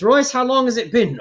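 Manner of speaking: noble lady. Acted. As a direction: play the lady, calling her servant. Lady character addresses servant.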